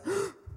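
sharp high gasp of fright; startled gasp. Retold or imitated. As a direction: gasp like the roar knocked her back. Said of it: "And she goes".

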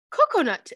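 "Coconut" with high, loud first syllable, falling away; high-pitched quoted "Coconut"; imitated odd pronunciation. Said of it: "Why the fuck are you saying it like that".

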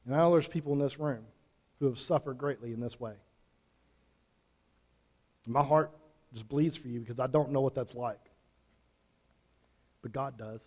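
soft, low and tender; tender sympathy. Real, not acted. Preacher speaking softly to parents who lost children, tender. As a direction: ease down gently for the grieving.

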